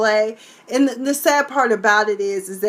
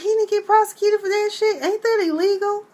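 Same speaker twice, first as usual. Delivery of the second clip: incredulous quote; 11 semitones higher than usual. Retold or imitated. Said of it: "I was like".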